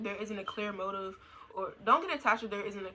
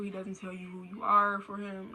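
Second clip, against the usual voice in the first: usual speaking voice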